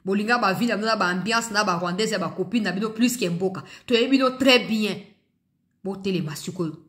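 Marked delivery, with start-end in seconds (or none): 3.9-5.0 louder, higher repeat; emphatic. Real, not acted. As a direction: hammer it home. (voix forte et appuyée)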